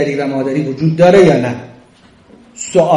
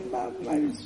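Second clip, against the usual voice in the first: mock whiny sick man; 12 semitones higher, 18 dB quieter than usual. Mimicking sick father's whine.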